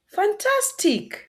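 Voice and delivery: teacher's big praise; excited praise. Shown as a demonstration. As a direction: praise them warmly big. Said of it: "praising children".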